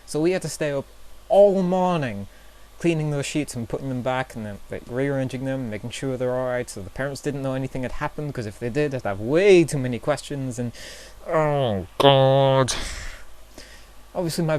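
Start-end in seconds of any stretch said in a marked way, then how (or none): 1.3-2.3 "all morning" pushed louder and higher; emphatic "all morning". Real, not acted. Vlogger complaining about the night's cleanup, "all morning" loud and high. Stressing how long the cleanup took. 11.2-12.7 loud, drawn-out cry of embarrassment; loud exasperated groan. Real, not acted. Vlogger recalling parents' possible questions, loud exasperated groan.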